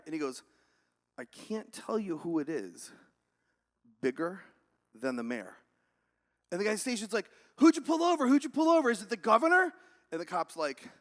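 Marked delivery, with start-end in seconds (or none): 1.2-5.6 slow, deliberate mock-serious reply; guarded cop. Cop withholding who he stopped. 7.6-9.7 loud, high-pitched frantic questioning; agitated dispatcher. Acted. Dispatcher growing frantic in joke.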